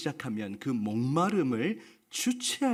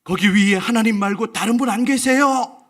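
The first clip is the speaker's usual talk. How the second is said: higher and much louder comic shout upward; loud yell; 7 semitones higher, 11 dB louder than usual. Acted. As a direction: bellow for someone else to help. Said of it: "크게 고함을 쳤습니다". (하나님 말고 다른 분을 찾는 다급한 고함)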